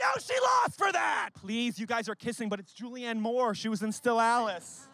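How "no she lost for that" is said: high, indignant shouted correction. Acted. Friend corrects passerby about the award, indignant shout. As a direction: shout it out in outrage.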